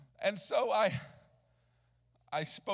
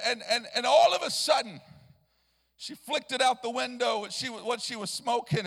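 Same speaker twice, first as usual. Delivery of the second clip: ordinary delivery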